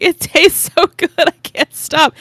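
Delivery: high and loud, gushing craving; hammed-up hungry whine; greedy mock plea. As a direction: beg like you can't stop eating. Retold craving, acted quote.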